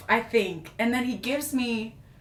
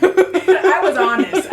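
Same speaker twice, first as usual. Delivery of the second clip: laughing; 8 semitones higher, 11 dB louder, much slower than usual. Real, not acted. Laughing confession of honesty.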